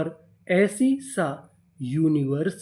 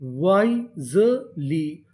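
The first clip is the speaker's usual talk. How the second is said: very slow, each syllable drawn out; teacher stretching the word syllable by syllable; slow spelled-out reading; 3 semitones lower, much slower than usual. Shown as a demonstration. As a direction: sound out the word slowly for learners. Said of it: "decision का मतलब निर्णय".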